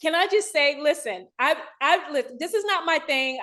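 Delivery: real emotion welling up in the words; emotional. Real, not acted. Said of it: "I am emotional because".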